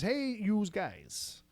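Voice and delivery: slow, drawn-out Noo Yawk greeting; 1930s beat cop. Shown as a demonstration. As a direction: lean into the thick New York accent. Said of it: "she loves to hear me say it like that".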